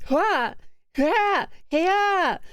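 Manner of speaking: short hero attack shouts; mock Link battle cries. Streamer faking Link's attack yells, hammed-up. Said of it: "I can't do a Link impression".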